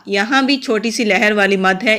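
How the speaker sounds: ordinary talk, nothing marked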